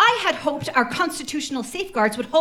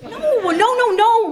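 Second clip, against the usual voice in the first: higher, louder insistent protest; 8 semitones higher, 5 dB louder than usual. Acted. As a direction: cut in urgently. Insistent questioner cuts in.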